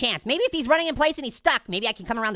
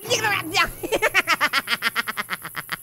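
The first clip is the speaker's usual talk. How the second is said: cracking up when caught; burst of laughter; 2 semitones higher than usual. Real, not acted. Player laughing at getting caught.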